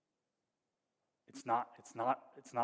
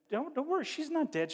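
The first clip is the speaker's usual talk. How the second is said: much higher, airy mock-soothing Jesus voice; playfully high-pitched Jesus brushing off the grief; voicing Jesus; 4 dB louder than usual. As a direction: float it up high like a breezy reassurance. Jesus voiced reassuring mourners.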